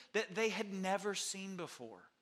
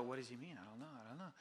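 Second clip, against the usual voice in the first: puzzled mumble; 8 semitones lower, 13 dB quieter, much faster than usual. Retold or imitated. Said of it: "think about it and go".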